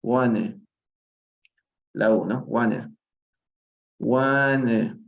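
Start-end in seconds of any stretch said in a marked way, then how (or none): 0.0-0.5 "wanna" with a weakened final vowel; reduced, T-less "wanna"; weak ending. Shown as a demonstration. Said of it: "se pronuncia de una forma muy débil". 2.5-5.0 "wanna" with the last vowel weakened; reduced "wanna", like "one" plus a weak vowel; weak ending. Shown as a demonstration. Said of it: "se pronuncia de una forma muy débil".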